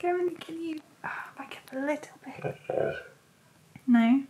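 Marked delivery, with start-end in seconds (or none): 0.0-2.6 whisper. Real, not acted. Dog too close to camera.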